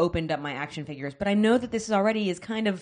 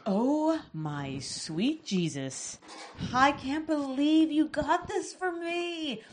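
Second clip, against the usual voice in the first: overwhelmed surprise; 7 semitones higher, 5 dB quieter, much slower than usual. Real, not acted. Reacting to gift.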